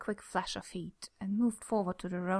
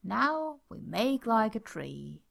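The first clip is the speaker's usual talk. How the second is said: very slow, savoring each word; slow punchline; much slower than usual. Acted. Jack finally landing his pun, slow and savoring. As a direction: drag out the punchline for effect.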